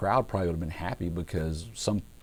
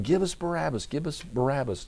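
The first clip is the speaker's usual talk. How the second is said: mock crowd yell; 7 semitones higher than usual. Preacher imitating the crowd before Pilate, high mock chant. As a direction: imitate an insistent mob in a raised pitch. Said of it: "they keep yelling".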